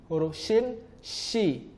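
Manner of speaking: slow "shi" with a spread sh sound; contrasting sin and shin. Shown as a demonstration. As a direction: draw out the sh of "shi". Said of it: "huruf sin dibaca si".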